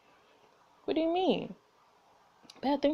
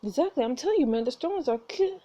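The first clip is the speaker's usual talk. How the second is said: ordinary talk, nothing marked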